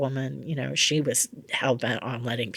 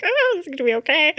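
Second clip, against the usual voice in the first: much higher, louder squeaky self-reassurance; hammed-up jitters, pitched way up and hurried; mock nervous; 7 dB louder, faster than usual. Improv psychic reassuring herself before an unknown question, mock nervous.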